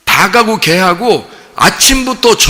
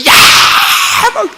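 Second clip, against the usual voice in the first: mock yell; 5 dB louder, faster than usual. Wives yelling from window.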